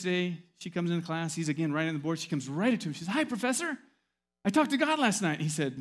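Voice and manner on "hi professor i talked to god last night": high, loud little voice, bright and eager; mock chirpy student agreeing; high quirky student. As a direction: squeak it out like the odd student.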